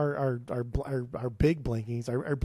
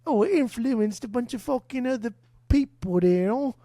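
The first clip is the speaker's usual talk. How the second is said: higher, louder mock English accent; bad English accent; 9 semitones higher, 4 dB louder than usual. Mocking what Skip will say, bad English accent. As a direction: play Skip as a snooty Englishman. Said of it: "That's my English accent always".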